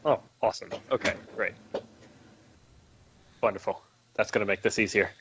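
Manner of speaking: low, deadpan sarcasm; sarcastic. Real, not acted. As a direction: say it dryly, clearly not meaning it. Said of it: "That was sarcasm".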